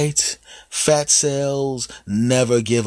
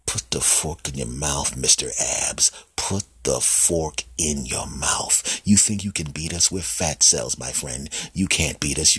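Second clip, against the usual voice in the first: menacing fat cells; 7 semitones lower, 4 dB quieter than usual. Acted. Fat cells taunting Mister Abs over cake, low menacing voice. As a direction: lean in and menace quietly.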